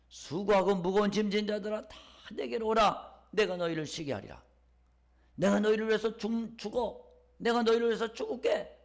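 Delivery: high, loud preacher's appeal in God's voice; impassioned invitation. Acted. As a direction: call out the invitation with full fervour. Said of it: "이렇게 초청을 하고 있어요".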